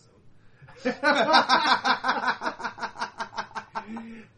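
loud, high burst of laughter; long, loud laugh that tails off; big laugh. Real, not acted. Game master hearing the exorcism book plan, loud laughing.

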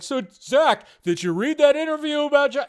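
mock interviewer voice. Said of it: "I'm not gonna be like".

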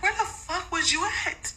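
squeaky disbelieving scolding; where were you, up high; high shocked scold. Retold or imitated. Owner scolds her dog that came home, high disbelief.

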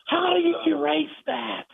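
high, slow, angry demand. Retold or imitated. Coach furiously orders the reporter to erase his tape.

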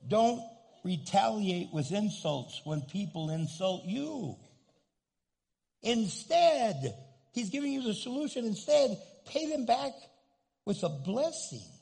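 loud, high, emphatic sermon push; forceful preaching. Real, not acted. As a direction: hammer each phrase home.

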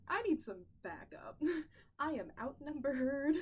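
laughing mock panic, high and loud; laughing plea. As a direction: beg for backup while cracking up.

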